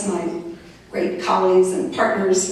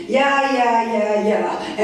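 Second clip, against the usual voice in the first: breezy dismissal; 2 semitones higher, much slower than usual. Retold or imitated. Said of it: "And I just went".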